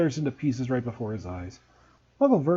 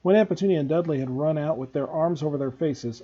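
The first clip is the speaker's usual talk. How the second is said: ordinary delivery, nothing marked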